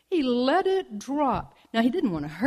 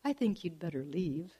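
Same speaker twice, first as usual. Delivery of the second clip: said sweetly; 4 semitones lower, 9 dB quieter than usual. Acted. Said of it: "The saguaro said sweetly".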